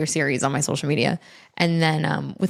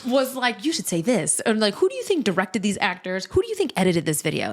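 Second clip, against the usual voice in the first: mock outrage; 3 semitones higher than usual. Mock outraged retort, laughing.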